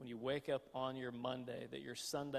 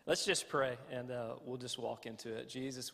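ordinary delivery

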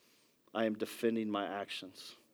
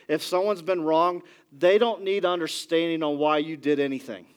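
building, louder sermon delivery; preacher driving his point home to the congregation, louder and rising; full-force preaching; 6 semitones higher, 7 dB louder than usual. Acted. Pastor warning the church about defensive apologies, preaching at full force.

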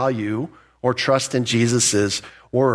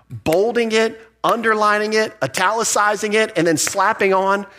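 high-pitched, punchy list; mounting emphasis; 8 semitones higher than usual. Real, not acted. Preacher showing how strong never is, emphatic. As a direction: hit each item high, "bolding", "underlining", "italicizing", "slapping on".

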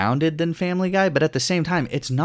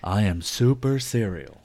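slow, mock Al Gore from South Park. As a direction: drag it out like the cartoon Al Gore. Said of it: "That Al Gore voice".

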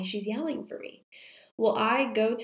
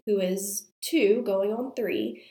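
normal talking voice

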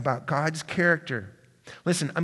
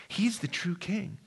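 normal talking voice

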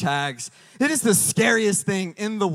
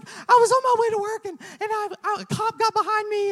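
very high, rushed fake-sob falsetto; mock crying wife; 12 semitones higher, 3 dB louder, faster than usual. Wife telling husband a cop got behind her, mock crying. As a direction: imitate a wife blubbering fast and squeaky. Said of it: "'Cause that's how Marissa's cries".

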